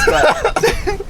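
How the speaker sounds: breaking into laughter. Real, not acted. Owner joking about his squeaking car, breaking into laughter. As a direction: start chatty, end laughing.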